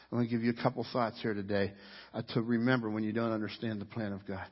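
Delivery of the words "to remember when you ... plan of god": usual speaking voice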